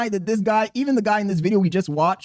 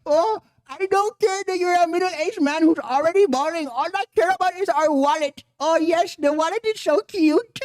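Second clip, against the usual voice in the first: higher-pitched Indian wife parody; mock adoring wife; 7 semitones higher than usual. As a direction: gush mockingly in a put-on accent. Continues parodying wife and wallet.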